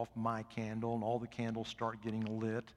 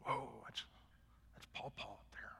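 put-on hushed, breathless whisper of an impressed child; awed whisper; 13 dB quieter than usual. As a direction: breathe it like a grandkid in awe. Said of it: "and they're just".

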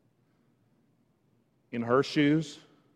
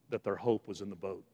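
soft, low first statement; hushed; 5 semitones lower, 8 dB quieter than usual. Real, not acted. Preacher tells congregation what disciples knew, hushed. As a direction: let it drop quietly, low in pitch. Quiet first take, then stronger repeat.

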